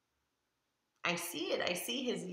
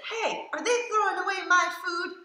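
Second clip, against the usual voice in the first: much higher, half-laughing dog voice; worried dog voice; 11 semitones higher, 3 dB louder than usual. Retold or imitated. Reader voices the dog fretting over his food, high and giggly. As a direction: squeak it out like an anxious puppy. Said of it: "He says".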